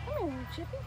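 high, sweet sing-song hello; cutesy greeting to a chipmunk. Real, not acted. Woman greeting a chipmunk on the trail, high sweet coo.

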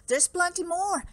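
lightly acted eager host; eager offer. A narrator's reading. Host pressing guest to stay.

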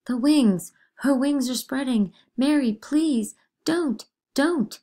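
high, loud panicked cry at a vision; girl pretending to see a bird, panicking; terrified shriek. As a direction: shriek in terror at the wings.

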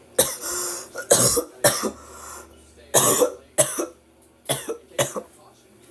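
long hacking coughing fit; real coughs in bursts. Real, not acted. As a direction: cough repeatedly from a throat tickle. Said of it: "random tickle in my throat".

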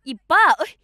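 a daughter screaming an insult at her aunt; furious yell. Acted. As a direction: hurl it at her. Angry outburst at the aunt.

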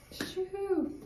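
high happy whoop; excited cheer. Real, not acted. Cheering good news.

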